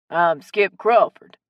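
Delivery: loud, slow Cajun drawl; hearty Cajun man, words stretched; strong Cajun accent. A narrator's reading. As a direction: introduce yourself in broad Cajun. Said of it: "in his strong Cajun accent".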